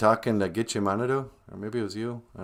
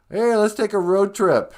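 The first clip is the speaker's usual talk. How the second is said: high, loud, drawn-out whoop of an idea; excited suggestion; 10 semitones higher, 6 dB louder, slower than usual. Acted. Drinker pitching a road trip after six beers, excited. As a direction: blurt it out like a grand plan.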